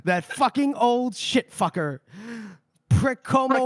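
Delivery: loud, high, spitting the curses out; furious old man swearing at Koska; pissed off cursing. Acted. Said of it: "Sweet fucking pissed".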